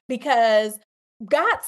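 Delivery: normal talking voice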